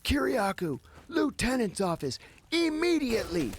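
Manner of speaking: guard calling out. Retold or imitated. Prison guard summoning him to the lieutenant's office, shouted call. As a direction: call the prisoner's name across the whole unit.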